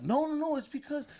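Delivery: high-pitched acted protest; panicked excuse. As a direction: plead your case, cut off mid-excuse. Pleading with the angry mom.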